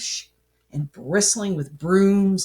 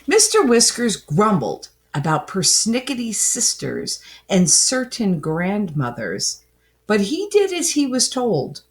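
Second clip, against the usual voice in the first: plain reading voice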